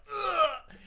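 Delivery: high, loud imitated grunt; awkward yelp. Retelling his friend's fall in the water, awkward yelp. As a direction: yelp as if slipping. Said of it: "makes this awkward, like".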